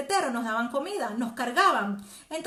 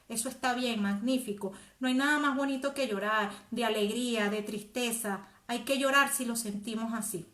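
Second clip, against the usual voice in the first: ordinary talk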